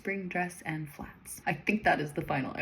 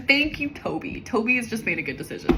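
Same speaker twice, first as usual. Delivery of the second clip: emphatic approval; 5 semitones higher, 8 dB louder than usual. Real, not acted. Reader praising a character.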